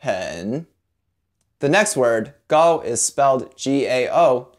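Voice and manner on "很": "很" low, dipping then rising. Shown as a demonstration. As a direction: sink low on "很" and curve back up. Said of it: "say with a dipping tone".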